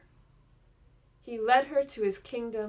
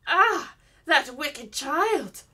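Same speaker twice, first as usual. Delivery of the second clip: loud, higher furious cry, slow on the last words; angry cry; 4 semitones higher, 10 dB louder, much slower than usual. A narrator's reading. Enchantress discovers Rapunzel's secret, loud furious cry. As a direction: explode with rage, drawing out wicked child. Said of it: "cried the enchantress".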